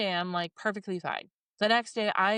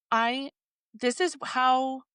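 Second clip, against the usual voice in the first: normal talking voice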